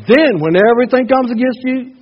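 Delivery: booming pulpit reading of scripture; full-force preaching. Acted. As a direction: proclaim it to the back row. Preaching climax.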